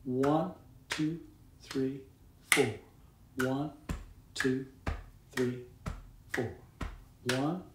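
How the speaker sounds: slow steady count, gaps growing; counting aloud, slowing. Shown as a demonstration. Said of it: "like this".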